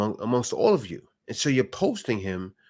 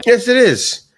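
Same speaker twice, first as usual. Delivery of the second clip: emphatic loud yes; 11 semitones higher, 11 dB louder, slower than usual. Real, not acted. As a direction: burst out loud and high in agreement. Agreeing loudly with the clip.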